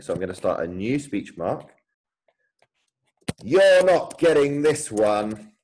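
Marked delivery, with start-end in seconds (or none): 3.4-5.6 slow, louder, higher defiant cry; thrilled challenge to a brother; excited exclamation. Acted. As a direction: fling it at your rival with relish. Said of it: "exclamation mark, more exciting".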